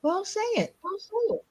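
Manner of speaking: a high, soft mock voice daring her to say it; high mock goading. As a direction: egg her on in a squeaky taunting voice. Goading the other to speak.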